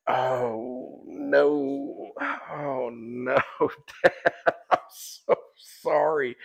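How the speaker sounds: high, drawn-out mock dismay; retold "oh no" dissolving into laughter; laughing sympathy. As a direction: commiserate while cracking up. Retold shocked sympathy, breaking into laughter.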